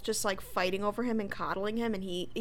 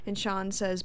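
ordinary delivery

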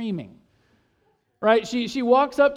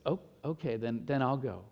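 put-on quiet child; 8 semitones lower, 9 dB quieter than usual. Daughter voiced, soft.

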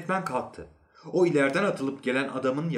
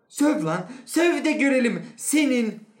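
very high, loud goading; shouting dare; 13 semitones higher, 6 dB louder than usual. A narrator's reading. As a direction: shout the challenge in his face. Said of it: "rahatlamak için bağırıyordu".

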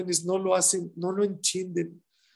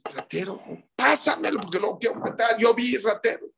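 mock furious woman; 5 semitones higher than usual. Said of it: "Así está la señora dice".

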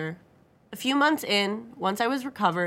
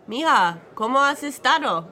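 fluent Spanish, higher and louder; flowing Spanish; 6 semitones higher, 6 dB louder than usual. A narrator's reading. Father asking his daughter how she has been, flowing Spanish. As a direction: greet warmly in flowing Spanish. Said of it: "he asked in flowing Spanish".

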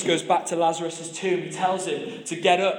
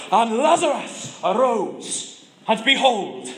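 put-on booming Welsh accent, high and loud, slow; bellowing Welsh preacher; 5 semitones higher, 5 dB louder, slower than usual. Preacher at a baptism bellowing the Lazarus story in Welsh accent. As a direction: proclaim it like a Welsh preacher in full flow. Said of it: "in his thick Welsh accent, he bellows".

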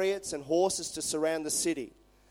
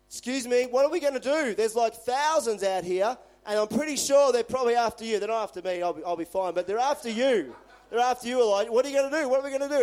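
higher-pitched, flustered and anxious; panicky servant; 5 semitones higher than usual. Retold or imitated. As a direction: babble like a servant who just saw an army. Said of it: "instantly afraid".

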